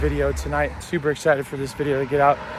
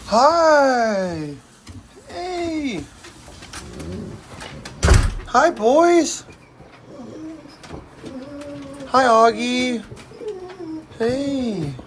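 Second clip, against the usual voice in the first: high sing-song voice for dogs; 10 semitones higher, much slower than usual. Real, not acted. Greeting the dogs, baby talk.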